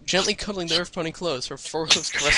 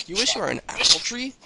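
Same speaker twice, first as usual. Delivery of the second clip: higher-pitched, puzzled question; shocked disbelief; 6 semitones higher than usual. A narrator's reading. Dash reacts to Applejack's odd wish, shocked disbelief. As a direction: act out a startled double take. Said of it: "doing a double take of shock".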